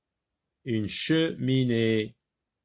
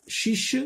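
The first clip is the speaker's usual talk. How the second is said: "Chiche" said much higher, ch brought out; ch sound stressed; 11 semitones higher than usual. Shown as a demonstration. Teacher showing the letters C H, high word. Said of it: "c'est C H".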